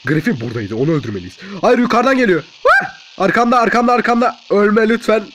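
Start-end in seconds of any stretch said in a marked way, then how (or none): 1.6-5.2 loud, high alarm; panicked gamer shouting. Real, not acted. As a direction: cry out as the attack closes in. Panic as enemy attacks.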